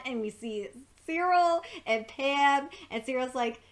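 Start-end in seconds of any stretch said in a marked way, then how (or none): none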